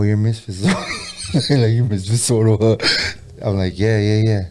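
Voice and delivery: ordinary talk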